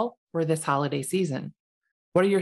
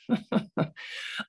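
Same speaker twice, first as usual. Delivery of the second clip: breathy laugh; 3 semitones higher, 6 dB quieter than usual. Real, not acted. Laugh at own remark.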